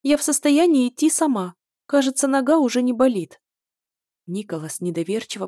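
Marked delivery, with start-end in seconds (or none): 0.0-3.4 put-on high, loud squeaky girl. A narrator's reading. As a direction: keep the thin squeak going. Princess continues squeaking.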